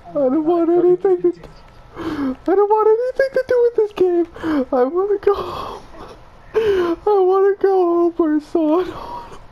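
high, fast, near-crying pleading; tearful panic. Real, not acted. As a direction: beg to go home, almost sobbing. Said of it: "I'm crying".

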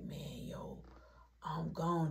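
soft unvoiced whisper. Sitting zonked on the couch, whispering to the group. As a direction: breathe it out, dazed. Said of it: "sitting there like".

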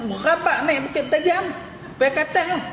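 high-pitched incredulous mockery; mock the climb in a high, worked-up voice; mocking exaggeration. Real, not acted. Preacher ridiculing cyclists who climb steep hills, mocking.

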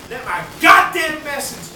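slow loud shout; angry demand. Real, not acted. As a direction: bellow that you want to be heard. Demanding to be heard.

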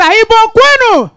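high call with a long falling end; rallying call. Real, not acted. Comedian asks the crowd to answer, rallying call. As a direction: holler it out for a crowd response.

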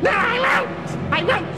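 high screeching no; defiant witch shout. A narrator's reading. The witch shouts back at God. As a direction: shriek back like a stubborn witch.

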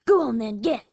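loud coyote bark. A narrator's reading. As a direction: bark a curt dismissal. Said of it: "licked her chops and barked".

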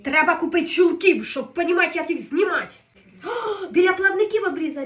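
loud, high, half-crying frustration; exasperated outburst. Real, not acted. Struggling with the pike skin, she cries out in frustration. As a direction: vent it out loud, close to tears.

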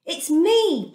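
lifts it high and bright, calling out to them; a glad cry of recognition; cried out. A narrator's reading. Joseph revealing himself to his brothers, a glad cry. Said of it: "Joseph cried".

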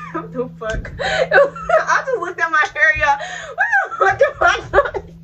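real giggly disbelief; laughing. Real, not acted. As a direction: crack up at yourself mid-sentence. Laughing at her own hair.

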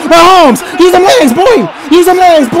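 high, excited urging; urgent cheering. Real, not acted. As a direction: spur the runner on from the couch. Urging quarterback to run.

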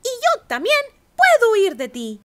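high, proud bragging; boasting. A narrator's reading. Gingerbread cowboy bragging to the coyote, high boast. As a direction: brag about every escape. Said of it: "se jactó".

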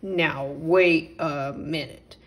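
indignant. Acted. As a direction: stop her short with offended dignity. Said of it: "said the mole indignantly".

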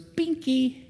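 put-on high little-kid voice; little son. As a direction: lightly mimic a toddler asking for a pacifier. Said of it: "And he goes".

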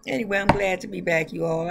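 ordinary delivery

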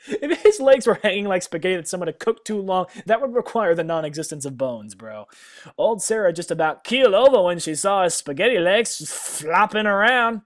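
loud, high incredulous roast; laughing disbelief, loud and high; mocking rant. Real, not acted. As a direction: ridicule the prose at full volume. Mocking the bad writing.

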